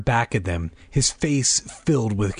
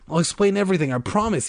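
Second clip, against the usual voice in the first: higher-pitched urgent promise; anxious, raised-pitch appeal; pleading; 8 semitones higher, faster than usual. A narrator's reading. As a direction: plead to be believed. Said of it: "he pleaded".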